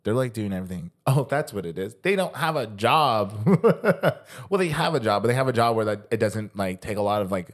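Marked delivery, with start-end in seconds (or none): 3.4-4.4 a sudden, high, loud laugh; high burst of laughter. Real, not acted. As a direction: laugh out at your own joke. Laughing at own joke.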